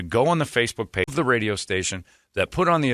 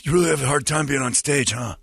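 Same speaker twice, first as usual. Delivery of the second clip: higher, put-on needling voice; Jeremy; 4 semitones higher than usual. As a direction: imitate a tactless colleague pressing the point. Mocking retelling of Jeremy's remark.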